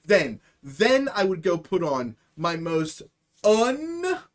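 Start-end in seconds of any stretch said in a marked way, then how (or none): 3.4-4.2 squeaky emphasis. Real, not acted. Comic emphasis word.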